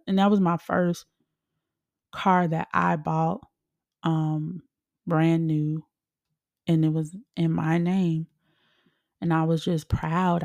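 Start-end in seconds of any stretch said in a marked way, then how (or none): none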